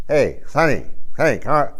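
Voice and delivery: mock old-timer with no teeth; toothless old man. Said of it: "You ever seen those old people?".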